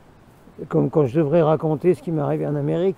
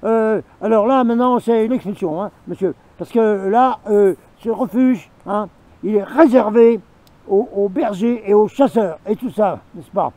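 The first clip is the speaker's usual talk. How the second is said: loud, higher retold bawling-out; mayor yelling; 4 semitones higher, 6 dB louder than usual. The mayor yelling at the hiker to leave the refuge, loud angry. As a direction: bark it like an officious mayor throwing you out. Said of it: "il commence à me gueuler dessus".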